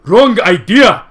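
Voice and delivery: loud, slow shout; angry shout. Acted. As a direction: cut her off angrily. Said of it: "Sonia interrupted her".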